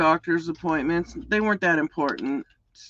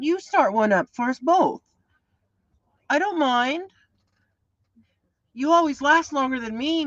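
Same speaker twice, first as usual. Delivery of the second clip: excited greeting, pitched much higher; 9 semitones higher, 3 dB louder than usual. Real, not acted. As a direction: greet a friend eagerly, voice lifted high. Greeting a friend joining live.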